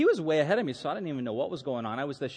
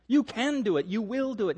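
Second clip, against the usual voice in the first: firm encouragement; 6 semitones higher than usual. Retold or imitated. Firm father encouraging his reluctant son.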